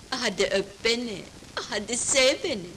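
soft, low come-on; low, hushed invitation to kiss; seductive coaxing. Acted. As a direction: coax softly, down low, with pauses. Seductive plea to reluctant husband.

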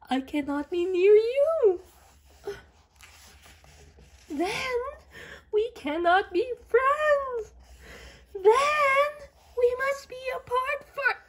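high, loud, crying lament; dramatic despair. Acted. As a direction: wail it like a tragedy.

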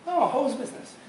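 lightly voiced customer pressing. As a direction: push the audience for an answer. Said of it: "Let's say I'm your customer".

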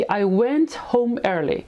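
very slow, carefully spaced words; slow clear classroom example; slow model sentence. Shown as a demonstration. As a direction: spell it out slowly for learners. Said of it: "میگی".

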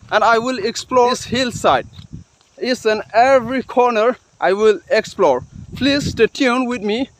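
normal talking voice

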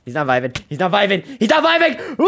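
from plain to high shriek; building to a shriek. Real, not acted. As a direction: rise into panic. Spider turns hostile.

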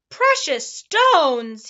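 slow, loud roar of the words. A narrator's reading. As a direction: bellow the words out, long and big. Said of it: "he roared".

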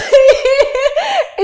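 loud, very high-pitched squealing laugh; big laugh. Real, not acted. Guest laughing at her own dramatic question, big high laugh. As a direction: let out a real high burst of laughter.

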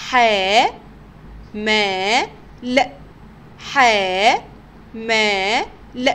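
ح، ما، ل as separate sounds, long "haa, maa", short "l"; teacher spelling ح، ما، ل slowly apart. Shown as a demonstration. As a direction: sound it out twice, syllable by syllable with long gaps. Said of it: "تعالوا نتهجى كده مع بعض".

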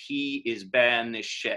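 banished as three syllables, the -ED pronounced in full; slow verse reading, voice the ED in "banished"; sounded ED. Shown as a demonstration. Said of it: "has an accent on that E D".

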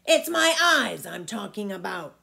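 put-on loud roar of an old giant; old giant roaring at the boy. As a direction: roar it out, fist on the table. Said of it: "roared the giant".

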